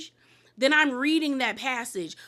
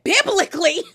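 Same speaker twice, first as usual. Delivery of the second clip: incredulous; 6 semitones higher, 8 dB louder than usual. Retold or imitated. Heather incredulous at Jen's question.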